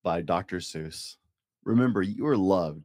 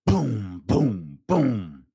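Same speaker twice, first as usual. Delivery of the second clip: louder thunder booms; 4 dB louder, much slower than usual. Acted. Dad reading to a child, thunder booms. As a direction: boom like thunder. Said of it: "Mister Brown makes thunder".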